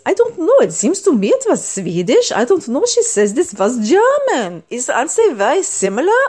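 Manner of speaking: high, sing-song Swedish accent. Shown as a demonstration. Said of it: "When you're talking like this".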